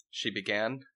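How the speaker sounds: normal talking voice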